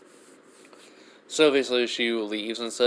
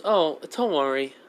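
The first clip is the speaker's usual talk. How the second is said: higher, slow reassurance; a goddess calmly soothing a worry; reassuring goddess; 6 semitones higher than usual. Acted. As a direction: Freya reassuring the Plague Monarch, higher and slow. Freya reassuring the Monarch.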